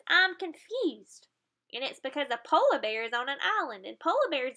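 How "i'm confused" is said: higher, slow, puzzled put-on voice; confused dolphin. Dolphin puzzled by the polar bear, high and slow. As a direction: sound baffled. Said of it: "dolphin says".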